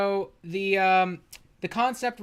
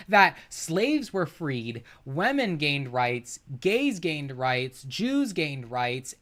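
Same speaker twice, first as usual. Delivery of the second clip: slow emphasis; 2 semitones lower, slower than usual. Real, not acted.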